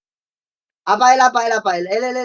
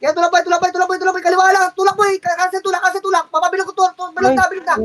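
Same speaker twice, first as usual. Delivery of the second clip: hyped push call; 7 semitones higher than usual. Real, not acted. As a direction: drive the team forward. Urgent push callout.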